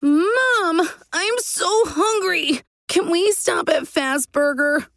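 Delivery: put-on high-pitched child's wail; shouted crying plea. A narrator's reading. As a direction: wail to Mom like a desperate kid. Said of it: "Gabe shouted and cried".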